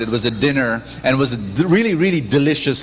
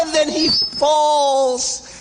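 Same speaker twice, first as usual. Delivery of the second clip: hammed-up fall; 12 semitones higher, much slower than usual. Re-dramatized fall in field.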